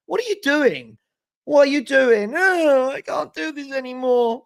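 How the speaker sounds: high, loud, exasperated what are you doing; hammed-up disbelief at a crying fan; exasperated mock quote. Imitating an overwrought crying fan.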